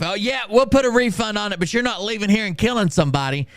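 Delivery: high, prissy mock of the kid. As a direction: lampoon him as smugly righteous. Mocking the U-Haul kid.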